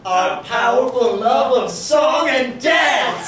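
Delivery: slow, high, booming declaration; grand drawn-out shout into a song; showbiz proclamation. Acted. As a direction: belt it out like a musical cue. Showy announcement leading into musical number.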